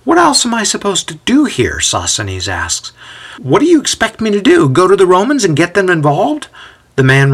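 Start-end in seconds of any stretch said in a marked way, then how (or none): 0.0-1.8 high, exasperated question; argumentative, at a loss; exasperated protest. A narrator's reading. 3.4-6.5 high, incredulous questioning; heated, protesting question; exasperated challenge. A narrator's reading. Sosthenes argues with his visitor.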